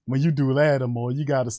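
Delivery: normal talking voice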